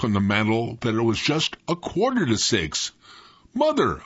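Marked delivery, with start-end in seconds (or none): 3.5-4.0 high-pitched yell, no real added volume; mock yell. A narrator's reading. Boy calling to his mother from bed, mock yell. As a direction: cry out high in protest. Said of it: "I yelled".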